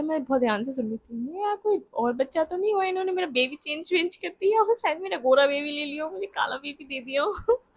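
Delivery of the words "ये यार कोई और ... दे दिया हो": higher, laughing half-cry of mock panic; laugh-crying fear the babies got swapped; panicked inner thought. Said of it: "मेरे दिमाग में ये चल रहा था".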